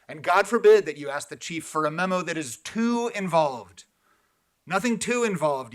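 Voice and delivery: loud, mocking emphasis; sarcastic rebuke. Real, not acted. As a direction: scoff it out loudly at the commissioner. Sarcastic rebuke of commissioner's questions.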